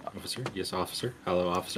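soft, low calling; quiet. Pretend. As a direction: murmur it under your breath.

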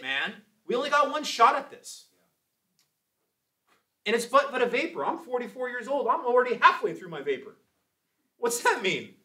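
high, loud rallying exhortation; fired-up preaching. Real, not acted. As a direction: punch each phrase to rouse the congregation. Preaching at full force.

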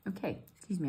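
normal talking voice

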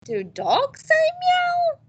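put-on high, slow, playful question to kids; a hammed-up teacher question. Shown as a demonstration. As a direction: ask it big and silly, slowly. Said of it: "I might stop here and say".

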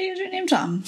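coy queen. Acted. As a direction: ask it in a high, playful put-on voice. Queen voiced, coy guess.

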